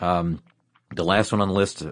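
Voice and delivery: ordinary talk, nothing marked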